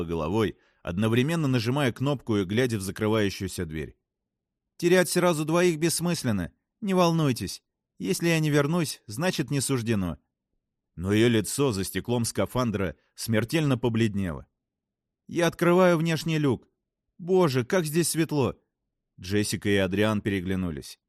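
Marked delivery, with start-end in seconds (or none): 4.8-10.2 higher-pitched woman reassuring her crew; resolute Frances. Acted. Voices a story character. 15.3-18.6 tag. Acted. Astronaut reacts to glaring light.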